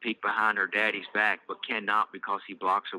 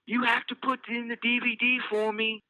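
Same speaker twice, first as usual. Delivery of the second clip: put-on high child voice calling out across the house; shouting little girl; 14 semitones higher than usual. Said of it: "before shouting".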